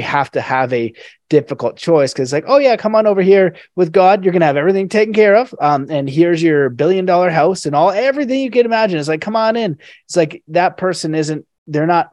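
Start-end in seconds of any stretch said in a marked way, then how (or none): 2.5-9.0 high, fast, loud tempting come-on; mock sales pitch. Mocking the dark side's easy offer in a high fast pitch. 9.2-9.8 mock invitation. Mock tempting offer continues.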